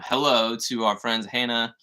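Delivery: ordinary talk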